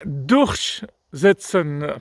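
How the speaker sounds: "Durchsetzen" very slowly sounded out; careful pronunciation. Shown as a demonstration. Practising a word hard to pronounce, "Durchsetzen" said very slowly. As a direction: draw out "Durchsetzen" syllable by syllable, working through it. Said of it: "Pas facile à prononcer".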